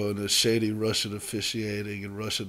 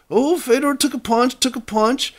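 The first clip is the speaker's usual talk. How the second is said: high, louder, brushing it off; imitating announcer; 10 dB louder than usual. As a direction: play down the hit like a biased commentator. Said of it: "they, they were like".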